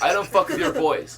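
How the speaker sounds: ordinary talk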